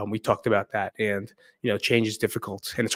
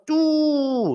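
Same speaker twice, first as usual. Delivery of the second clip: high held "doo" like an alert tone; notification chime; 4 dB louder than usual. Retold or imitated. Imitating notification sound.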